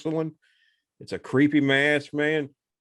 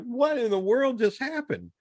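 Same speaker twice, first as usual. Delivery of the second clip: high, bewildered disbelief; baffled and shaken; stunned; 12 semitones higher than usual. Retold or imitated. Friends stunned by masked girl.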